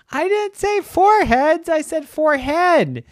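very high, loud insistence; upset kid. Acted. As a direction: correct her desperately. Brother insisting.

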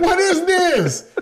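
high, loud, slow laughing "what is this"; a rookie who can't believe he's being hit; stunned disbelief. Retold or imitated. Stunned rookie getting punched.

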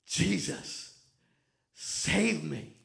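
low, soft desperate plea; cry for help. Retold or imitated. Peter sinking, crying out softly to Jesus. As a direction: beg to be saved, quietly. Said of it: "He cried out".

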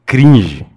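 "cringe" hit loud and high; stressed cringe. Real, not acted. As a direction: punch the word "cringe". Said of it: "Tem que usar essa palavra".

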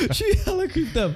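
high giggling; laughing. Real, not acted. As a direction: giggle through it. Laughing at her on screen.

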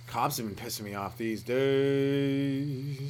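slow, last word held very long; drawn-out gripe. Real, not acted. Comedian complaining about cops, drawn-out gripe. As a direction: stretch the final word out like a note.

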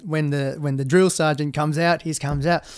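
ordinary delivery

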